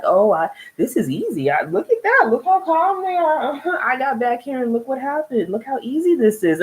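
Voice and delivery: high, mocking sarcastic brag; mock his smug magic-hands boast. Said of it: "He's being sarcastic".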